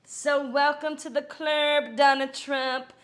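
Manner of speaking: loud, gushing mock welcome; mock celebration. Mock-welcoming Trump to the club, loud and gushing. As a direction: cheer him into the club, hamming it up.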